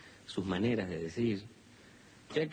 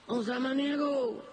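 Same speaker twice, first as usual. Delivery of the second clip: llamando desde la tranquera, voz mucho más aguda y lenta; llamado desde lejos; slower than usual. Acted. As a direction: alarga el llamado como gritando de lejos. (Florentín llama a Samaniego desde la tranquera, voz aguda y lenta)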